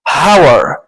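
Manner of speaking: "hour" with an audible H, "hower"; نطق ساذج بالهاء. Shown as a demonstration. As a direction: say "hour" loudly with the H sounded. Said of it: "هننطقها إزاي؟". ("hour" بهاء منطوقة "هاور")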